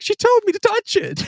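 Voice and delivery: high comic whine blaming her; sheepish excuse. Retold or imitated. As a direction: squeak it out like a caught kid. Jonathan excuses himself to Seward.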